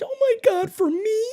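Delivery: very high, loud squeal of delight; imitated daughter. Daughter given a Toothless backpack plushie, high mock squeal of delight. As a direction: squeal it like a thrilled teenage girl. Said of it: "She's like".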